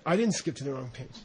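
low, soft, calm denial; lama calmly denying the mistake, low and soft; flat denial. Retold or imitated. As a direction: deny it simply and quietly. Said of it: "He just said it like that".

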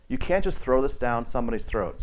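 ordinary talk, nothing marked

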